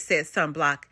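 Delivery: ordinary delivery, nothing marked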